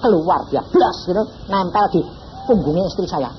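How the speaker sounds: usual speaking voice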